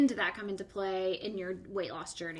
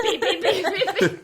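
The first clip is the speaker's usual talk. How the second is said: censor bleeps; 10 semitones higher, 12 dB louder than usual. Retold or imitated. Listener imitates the bleeps.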